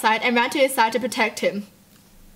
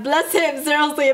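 normal talking voice

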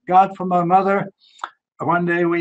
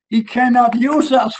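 preaching emphasis; 7 semitones higher, 4 dB louder than usual. Real, not acted.

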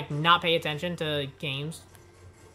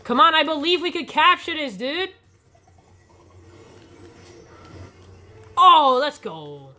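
excited gamer; 9 semitones higher, 9 dB louder than usual. Real, not acted. Pushing to capture.

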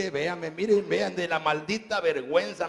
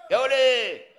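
high, loud call across the room; loud hollered greeting; shout; 8 semitones higher, 8 dB louder than usual. Real, not acted. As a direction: yell it out to the crowd. Calling out over the crowd.

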